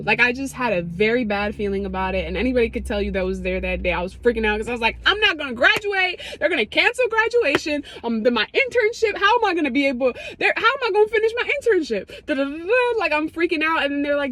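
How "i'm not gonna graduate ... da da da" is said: high, frantic worrying; panicking. Retold or imitated. Intern panicking about graduation, high and frantic. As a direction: spiral into panic, pitched up.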